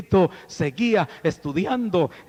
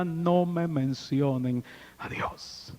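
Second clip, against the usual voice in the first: soft, slow "No me mencionen a Dios", stress on "No"; bitter murmur; 2 semitones lower, 7 dB quieter, slower than usual. Retold or imitated. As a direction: mutter "No me mencionen a Dios" under the breath. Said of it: "él decía".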